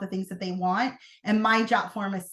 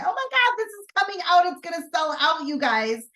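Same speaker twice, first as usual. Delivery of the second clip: high, gushing sales hype; mock excited announcement; 7 semitones higher, 3 dB louder than usual. Acts out an excited sales email.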